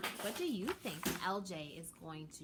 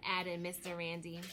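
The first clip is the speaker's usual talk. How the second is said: plain reading voice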